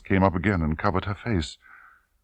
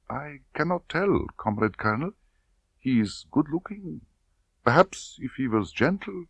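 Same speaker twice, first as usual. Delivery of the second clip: soft, hesitant; girl unsure under questioning; shy young woman; 9 semitones higher, 3 dB quieter than usual. Acted. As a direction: answer haltingly. Tatiana answers.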